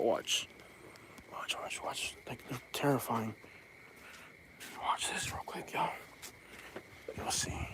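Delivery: very soft, secretive whisper, barely any voice; hushed, whispered warning. Real, not acted. Creeping near the neighbor's dogs, whispering to viewers.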